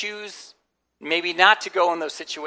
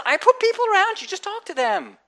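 a much higher, gentle voice for God; God's voice; 13 semitones higher, 6 dB louder than usual. Pretend. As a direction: speak as God, lightly and kindly. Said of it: "God says".

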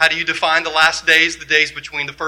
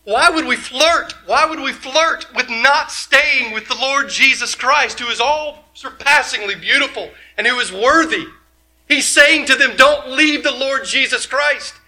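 preaching at full force; 8 semitones higher than usual. Acted.

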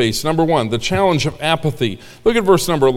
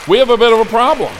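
loud, fast, punchy; emphatic; 6 semitones higher, 5 dB louder, faster than usual. Acted. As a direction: hit it hard and fast. Punchy preaching line.

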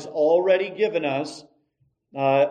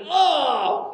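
a higher, louder yell of pain; mock pained yell; 10 semitones higher, 6 dB louder than usual. The wrestling partner after the collision, a mock pained yell. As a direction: cry out like you just got knocked down. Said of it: "And he was going".

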